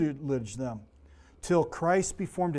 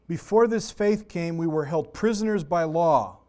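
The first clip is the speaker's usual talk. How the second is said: scripture reading; 4 dB louder, slower than usual. Acted. Reads scripture aloud.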